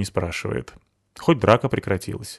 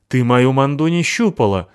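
angry retort; 5 semitones higher, 6 dB louder than usual. Acted. Перебранка Натахи и Абуты.

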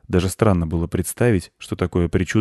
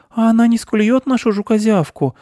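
put-on very high, loud anxious girl; high-pitched child fretting; worried; 6 dB louder than usual. A narrator's reading. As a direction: squeak it out like an alarmed small girl. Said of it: "заволновалась девочка Анечка".

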